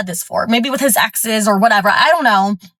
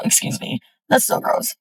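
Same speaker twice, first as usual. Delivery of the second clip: whispering to herself; breathy whisper; whispered aside; 2 semitones higher, 7 dB quieter than usual. Real, not acted. Aside after needing to burp.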